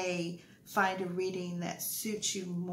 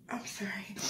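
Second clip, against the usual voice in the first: whispered aside; 3 semitones higher, 4 dB quieter than usual. Real, not acted. Aside before a sneeze.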